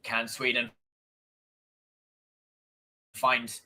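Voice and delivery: lively football commentary, pushing on the chances; commentating the final minutes for the viewers, eager play-by-play. Acted. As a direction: call the match like a TV commentator. Does own live match commentary.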